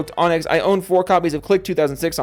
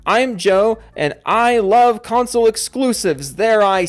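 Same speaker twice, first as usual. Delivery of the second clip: mock confession; 5 semitones higher, 4 dB louder, slower than usual. Reading fan's joke line.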